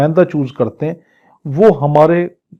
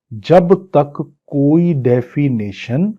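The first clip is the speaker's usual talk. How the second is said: usual speaking voice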